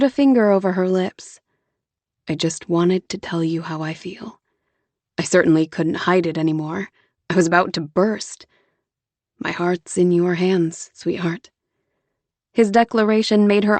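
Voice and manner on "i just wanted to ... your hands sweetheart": Jonah, patient and gentle. Acted. Jonah voiced.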